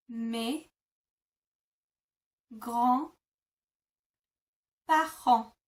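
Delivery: mes grands-parents stretched very slowly; slow teaching demo; slow syllable demo. Shown as a demonstration. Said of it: "to say my grandparents".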